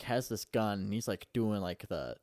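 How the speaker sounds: ordinary delivery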